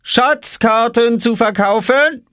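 very high, drawn-out vendor cry; calling out for sale. A narrator's reading. Boy hawks maps at his robbed sister, high drawn-out call. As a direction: stretch out the sales call, provocatively. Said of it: "rief Oskar".

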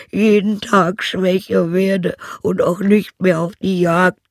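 put-on high, loud lament of an old hound; pitiful howling dog. A narrator's reading. Old hound tells why he fled, pitiful howling dog voice. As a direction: wail it out high and woeful. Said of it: "der jämmerlich heulte".

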